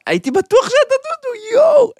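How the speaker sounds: squeaky high, loud mock embarrassment; hammed-up apology. Prankster pretending shame to the giant he slapped. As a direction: overplay the sorry-I-was-sure act.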